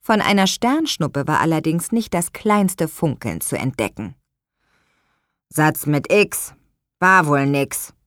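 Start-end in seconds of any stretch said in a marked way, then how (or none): 5.5-7.9 grumpy mutter. Acted. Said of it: "murmelte Luis missmutig".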